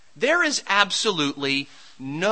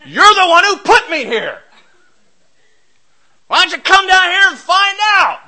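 loud, higher, resentful shouting; mock angry outcry; 8 semitones higher, 12 dB louder than usual. Daniel shouting up at the king who put him there, mock angry. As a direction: yell it up as a bitter accusation. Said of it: "Daniel doesn't cry out from the den".

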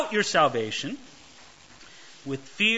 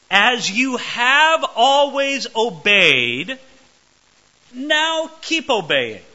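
higher, louder, slow and tender; tender pastor; 5 semitones higher, 7 dB louder, much slower than usual. Shown as a demonstration. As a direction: read it slowly like a caring pastor. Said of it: "how tenderly he puts this as a pastor".

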